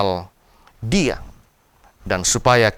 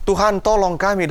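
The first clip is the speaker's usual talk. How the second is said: a higher, imploring cry to God; earnest plea; 7 semitones higher than usual. Shown as a demonstration. Preacher showing the congregation how to cry out, imploring plea. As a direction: lift the voice up as you plead. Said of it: "berseru dan memohon".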